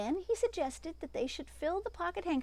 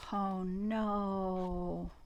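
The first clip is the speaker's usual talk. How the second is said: oh no; 5 semitones lower, 4 dB quieter than usual. Acted. Rabbits see the cat.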